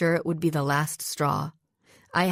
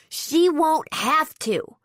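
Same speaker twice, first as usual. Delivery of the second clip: put-on slow, high, louder confident kid; confident high-pitched voice; 10 semitones higher, 4 dB louder, slower than usual. A narrator's reading. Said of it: "A confident but rather high-pitched voice".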